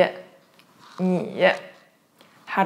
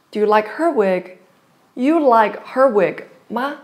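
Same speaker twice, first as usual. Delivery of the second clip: ordinary talk, nothing marked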